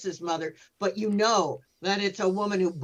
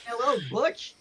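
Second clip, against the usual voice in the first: quoted greeting. Retold or imitated. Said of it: "she says".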